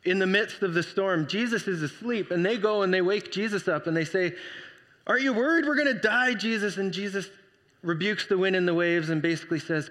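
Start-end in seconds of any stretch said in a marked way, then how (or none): 5.0-6.8 anxious. Retold or imitated. Disciples wake Jesus in storm.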